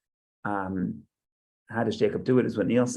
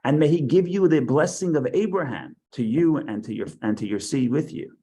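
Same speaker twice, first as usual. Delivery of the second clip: higher, louder solemn reading voice; scripture reading; 3 semitones higher, 3 dB louder than usual. Acted. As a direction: proclaim the verses to the class.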